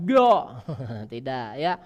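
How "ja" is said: ja said thickened and loud, the wrong way; wrong heavy ja; thick wrong ja. Shown as a demonstration. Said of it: "tidak boleh ditepalkan".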